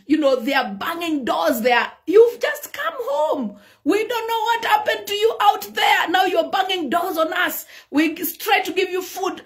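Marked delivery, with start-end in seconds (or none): 2.1-9.4 raised, higher voice complaining; family complaining. Retold or imitated. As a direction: tell him off for banging doors. Family complaining to angry parent.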